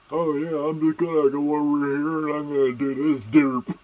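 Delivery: loud, high, goofy dope voice; derpy guy. Shown as a demonstration. Dopey guy announcing his plan, derpy voice. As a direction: play a clueless derp, high and loud. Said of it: "what the fuck was that wrong with that guy".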